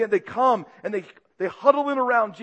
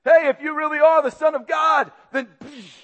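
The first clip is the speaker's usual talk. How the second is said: louder, sneering jeer; mocking jeer; 7 semitones higher, 5 dB louder than usual. Guards mocking blindfolded Jesus, loud jeer. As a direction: laugh at him with the question. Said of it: "They mocked him".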